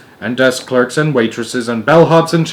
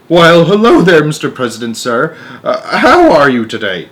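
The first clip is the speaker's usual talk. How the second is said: fawning; 4 semitones higher, 6 dB louder than usual. A narrator's reading. Shanks fawning to President.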